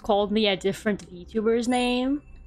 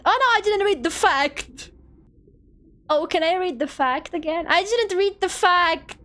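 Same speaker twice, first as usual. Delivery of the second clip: high, startled "oh no"; alarmed, realizing a mistake; panicked; 7 semitones higher than usual. Real, not acted. Panics over missed fact.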